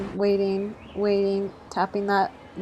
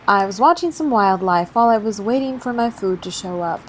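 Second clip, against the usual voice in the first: usual speaking voice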